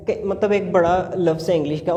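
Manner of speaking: normal talking voice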